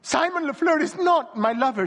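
high, loud, sobbing protest; sobbing denial. A narrator's reading. Wife sobbing her denial to her husband, high and loud. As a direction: plead through tears. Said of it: "she sobbed".

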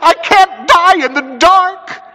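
hysterical, loud high wail; voice breaking. A narrator's reading. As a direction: plead in cracked, tearful terror. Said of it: "his voice broke as a boy's does".

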